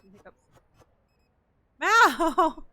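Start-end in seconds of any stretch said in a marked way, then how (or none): 1.8-2.6 sharp loud call of the cat's name. Real, not acted. Calling out to the cat, a loud sharp yell. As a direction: shout her name across the room.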